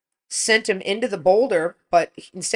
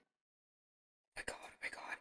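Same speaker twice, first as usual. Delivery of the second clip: breathless whispered oh my God; mother whispering on seeing the wrecked truck; shocked whisper; 27 dB quieter than usual. Retold or imitated. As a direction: whisper it in disbelief. Retold shock at the crash.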